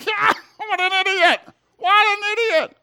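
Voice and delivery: very high, loud mock jeering; hammed-up scornful heckler, far above normal pitch; sneering mockery. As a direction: laugh and jeer like a heckling crowd. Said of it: "They sneered at him".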